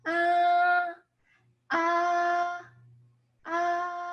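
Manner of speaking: "ah" held high on one level pitch; calm first tone. Shown as a demonstration. Teacher shows the peaceful tone, high level "ah". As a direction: sustain a calm, high, even "ah". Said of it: "You said it was a calm tone".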